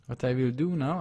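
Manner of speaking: ordinary talk, nothing marked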